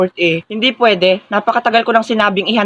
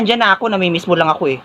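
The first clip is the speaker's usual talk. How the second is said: ordinary delivery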